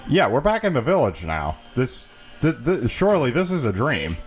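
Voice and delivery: normal talking voice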